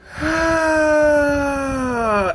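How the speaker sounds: high, loud wailing sigh, almost a cry; exaggerated moan of self-pity; loud theatrical sigh. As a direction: heave a big dramatic sigh for attention. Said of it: "they come by again".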